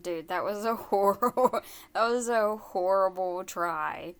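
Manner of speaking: loud, appalled reaction to a bad attempt; loud disbelief. Real, not acted. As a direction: blurt it out loudly, half laughing. Listener reacting loudly to failed accent.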